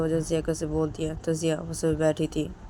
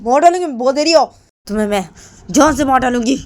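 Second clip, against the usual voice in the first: much higher and much louder shouting; furious threat; 9 semitones higher, 12 dB louder, faster than usual. Acted. Jiya threatening Ritu after slap, furious high shout. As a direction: scream the threat in rage.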